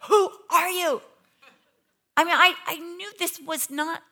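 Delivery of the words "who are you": high, loud, frantic question; shouting at the mirror. Retold or imitated. Preacher recalling her meltdown, shouting at herself in the mirror, high and loud. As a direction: yell at your own reflection. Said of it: "I even looked in the mirror like".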